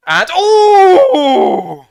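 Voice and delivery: sudden very high, loud ooh cry; shocked cry. Real, not acted. Fan crying out in shock at a play-action snap.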